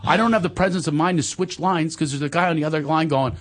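ordinary talk, nothing marked

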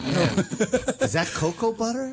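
mock creepy come-on. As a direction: play the creep getting too close. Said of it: "that sounded creepy the way I said it".

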